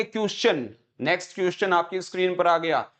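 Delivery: क्वेश्चन said as 'kyus-chun'; imitated mispronunciation. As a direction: mimic others saying क्वेश्चन with a 'kyu' start. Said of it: "या तो बोलेंगे क्वेश्चन". (क्वेश्चन, 'क्युश्चन' वाला गलत उच्चारण)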